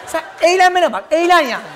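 hurt, heated dismissal; acted angry retort. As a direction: throw it at your partner in a huff. Acted angry quarrel of a couple.

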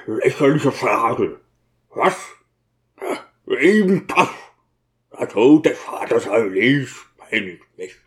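gruff, brooding character voice; irritable, grieving deacon; tormented deacon, grumbling. Acted. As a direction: grumble as a man eaten by grief.

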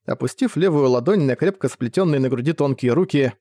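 ordinary delivery

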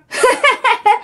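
a high, gleeful laugh; pleased ghostly laugh. A narrator's reading. Ghost daughter laughs to mother, gleeful. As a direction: laugh with contentment.

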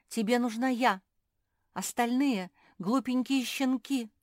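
loud, high-pitched demand; insistent boasting. A narrator's reading. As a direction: push yourself forward and dismiss the rivals. Rival pushes herself, scorns puppies.

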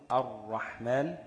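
الرحمن slowed as ar-raḥmān, l silent; slow demo of assimilation. Shown as a demonstration. Teacher shows students the merged r, الرحمن slow. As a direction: stretch الرحمن with a doubled r. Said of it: "تقلب اللام".

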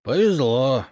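drawn wistful exclamation; envious sigh. A narrator's reading. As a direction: sigh with envy at another's luck. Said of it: "с завистью вздохнул пьянчуга".

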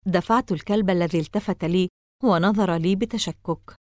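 ordinary delivery

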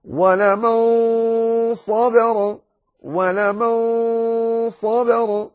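ولمن صبر with a full, heavy nasal on ولمن, very slow and high; chanted slowly, heavy nasal; heavy ikhfa before ص. Shown as a demonstration. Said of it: "فتكون".

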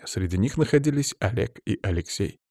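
ordinary delivery, nothing marked

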